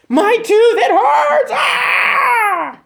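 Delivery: high, loud mock scream of tooth pain. Patient in the dentist's chair yelling at the dentist, hammed-up pained scream. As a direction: wail like a patient in agony at the dentist. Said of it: "they're in there screaming".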